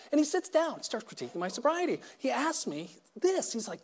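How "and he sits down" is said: normal talking voice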